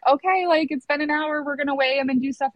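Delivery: a hint of perky caregiver; chirpy nurse. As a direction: lightly voice an upbeat nurse. Staff briskly announcing next step, retold.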